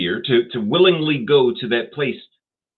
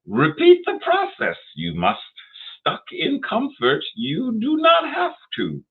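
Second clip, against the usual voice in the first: very high, strained Yoda voice, louder on the commands; Yoda impression; 3 dB louder than usual. Host repeating Yoda's advice more boldly, very high Yoda voice. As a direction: go all in on Yoda, squeaky and emphatic. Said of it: "my Yoda impersonation".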